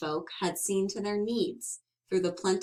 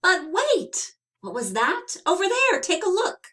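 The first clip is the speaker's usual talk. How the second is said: much higher and louder, eager; excited discovery; 10 semitones higher, 5 dB louder than usual. A narrator's reading. Narrator spotting the pine cone for the listeners, excited. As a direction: point it out with sudden thrill.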